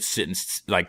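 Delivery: normal talking voice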